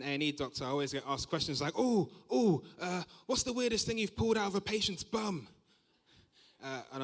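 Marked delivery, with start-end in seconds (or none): 1.8-5.5 high, excited oohing question; mock eager curiosity. Party guest to an A and E doctor, eagerly curious. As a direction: ask it like a nosy, thrilled guest.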